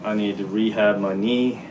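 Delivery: normal talking voice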